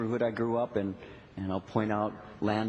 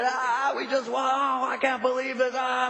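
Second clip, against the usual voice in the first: high, excited fan shouting; worked-up, high-pitched sports-fan outburst; retold screaming; 11 semitones higher, 4 dB louder than usual. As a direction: yell in disbelief at the winning shot. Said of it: "I'm screaming".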